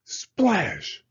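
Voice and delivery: high, loud burst on SPLASH; exclaimed splash. A narrator's reading. Narrator as Hedgehog lands in muck, sudden exclamation.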